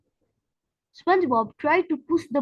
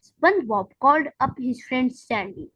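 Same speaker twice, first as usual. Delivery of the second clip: ordinary delivery, nothing marked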